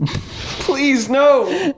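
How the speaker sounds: high frightened please, no; cowering plea. Acted. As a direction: beg in mock terror. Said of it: "What's your scared voice?".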